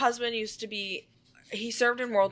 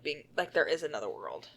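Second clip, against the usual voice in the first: ordinary delivery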